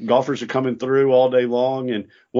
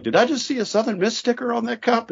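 high, amazed mock question; retold golfer surprised by the sticker, pitched up; imitating surprised stranger; 13 semitones higher than usual. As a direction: mimic a stranger spotting the sticker.

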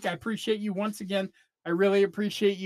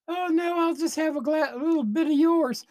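lifted, dainty polite refusal; dainty guest voice; 9 semitones higher than usual. Acted. Pauline coyly asks to sip her friend's wine, high and dainty. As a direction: pitch it up like a prim lady guest.

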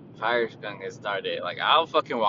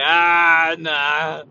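mock refusal; 6 semitones higher, 13 dB louder than usual.

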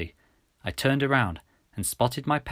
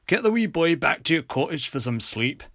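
lifted, lilting Scottish officer; kindly Scottish officer, higher pitched; Scottish police officer; 5 semitones higher, 3 dB louder than usual. Acted. Officer Roebuck's accented dialogue.

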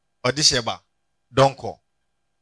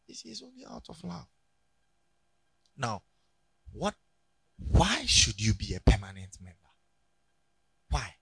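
usual speaking voice

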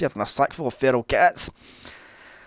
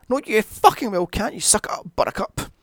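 mock harsh mother; 8 semitones higher, 3 dB louder than usual. Mock mother answering child.

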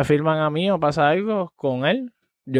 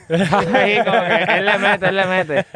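laughing; 3 semitones higher, 4 dB louder than usual. Retold or imitated. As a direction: quote it through a laugh. Laughing while quoting them.

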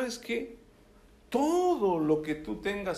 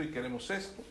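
trailing off softly; 7 semitones lower, 10 dB quieter, much faster than usual. Acted. Last of four repeats, fading.

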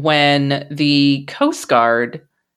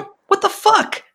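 baffled disbelief. Real, not acted. Reacting to the abrupt movie ending.

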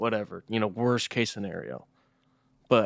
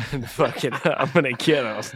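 laughing mock threat; 4 semitones higher, 7 dB louder than usual. Laughing through his imagined threat.

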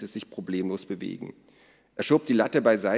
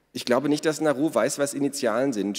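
raised, higher, needling voice; teasing jibe; 5 semitones higher, 4 dB louder than usual. A narrator's reading. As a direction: needle your brother with it. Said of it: "stichelte Ada".

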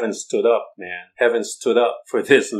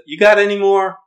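eager prophet; 10 semitones higher, 9 dB louder than usual. Acted. Prophet asking Jesse for more sons.

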